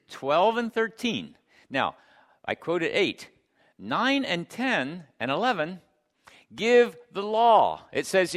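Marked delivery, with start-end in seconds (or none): none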